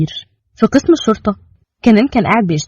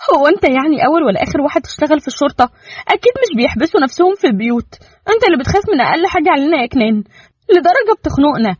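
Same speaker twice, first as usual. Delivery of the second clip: higher-pitched wife, mildly indignant; voiced young wife arguing; 8 semitones higher than usual. Wife protesting Kenan's overprotectiveness, mildly indignant. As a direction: push back on him with mild frustration.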